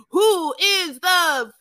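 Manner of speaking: loud, drawn-out demand imitating an angry man; mock confrontation. Boyfriend marching into the men's quarters, mock-angry and loud. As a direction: storm in and demand, hammed up. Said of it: "march into the men's quarters and be like".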